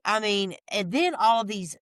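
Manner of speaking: usual speaking voice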